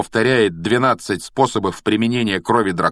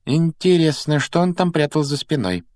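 higher-pitched musing question; thoughtful wondering; 7 semitones higher than usual. A narrator's reading. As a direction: wonder aloud, lightly pensive. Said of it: "задумчиво спросила Гермиона".

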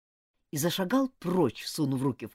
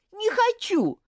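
pleading shout; 7 dB louder than usual. Acted. Girl pleading with angry friend.

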